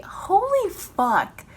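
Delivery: anguished howl; anguished cry. Real, not acted. Emotional outburst.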